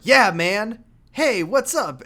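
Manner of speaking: a light American bro voice; American accent. Retold or imitated. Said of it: "and he's American".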